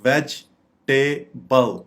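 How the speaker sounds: "वेजिटेबल" split slowly, VEJ, TAY, BUL; syllable by syllable. Shown as a demonstration. Teacher showing syllables, "वेजिटेबल" as VEJ ... TAY ... BUL. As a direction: pause between the three parts of "वेजिटेबल": vej ... tay ... bul. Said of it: "इसके syllables देखें".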